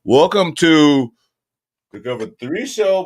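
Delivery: hype show intro. Acted. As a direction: call it out like a show host. Podcast intro hype greeting.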